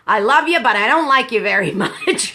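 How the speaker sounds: teasing mother voice, ending in laughter; imitating mother. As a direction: quote mom with fond exasperation. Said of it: "my mother used to say".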